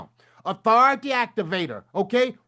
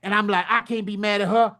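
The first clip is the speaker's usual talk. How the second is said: ordinary talk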